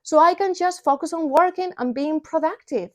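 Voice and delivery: ordinary talk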